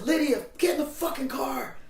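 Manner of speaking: high shouted call across the street; yelling from car. Retold or imitated. Lydia hollering at her friend from the car. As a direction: yell it out the car window.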